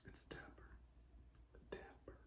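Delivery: barely audible whispered aside; whispering. Real, not acted. Man to camera about his outfit, whispering. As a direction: whisper it almost without voice.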